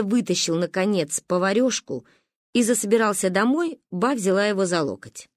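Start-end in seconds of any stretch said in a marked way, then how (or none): none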